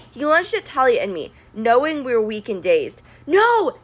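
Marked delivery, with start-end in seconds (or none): 3.3-3.8 high sharp shout; yelled no. A narrator's reading. Annabeth shouting as she charges the monster. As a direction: cry out to stop them. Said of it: "Annabeth yelled".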